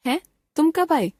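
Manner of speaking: high, startled question; surprised. Shown as a demonstration. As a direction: sound caught off guard by the arrival. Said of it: "विस्मय या आश्चर्य सूचक". (ऊँची आवाज़ में हैरानी भरा सवाल)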